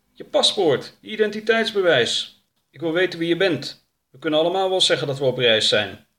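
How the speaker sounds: impatient armed questioner; stern older man. Acted. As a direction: demand identification, loud and insistent.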